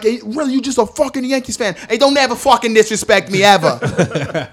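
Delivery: loud, high-pitched hothead imitation; mock angry Bostonian. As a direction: rant like an offended Boston fan. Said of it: "And they be like".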